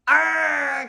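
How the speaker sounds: loud villain's angry roar. Acted. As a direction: let out a frustrated growl of rage. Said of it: "Count Olaf roared".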